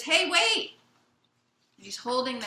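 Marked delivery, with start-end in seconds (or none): 0.0-0.7 put-on high, loud kid's shout; boy calling out. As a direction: call after someone hurrying away. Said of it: "he runs after the man and he says".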